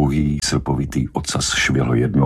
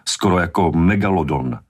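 ordinary delivery

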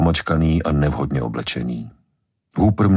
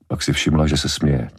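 ordinary delivery

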